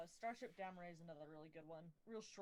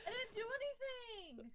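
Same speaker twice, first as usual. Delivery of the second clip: loud, high-pitched protest; indignant protest; 13 semitones higher, 5 dB louder than usual. Real, not acted. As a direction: insist on innocence, loud. Protesting the glitch.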